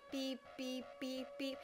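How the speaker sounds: soft, even alarm beeps; imitated beeping. Teller showing how the beeping began, softly imitated beeps. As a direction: imitate a beeper in evenly spaced beeps. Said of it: "it's not just beeping".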